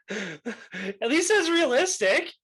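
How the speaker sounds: high, slow, laughing. Real, not acted. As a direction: giggle through the line. Laughing at own pick.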